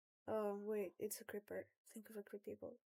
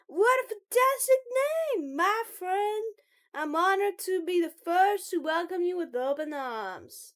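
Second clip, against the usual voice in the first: shouted, over-the-top welcome; Jared; 8 semitones higher, 13 dB louder than usual. Acted. Loud club member welcoming the recruit with open arms, shouting. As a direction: proclaim it loudly to a new friend. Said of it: "could you please stop shouting?".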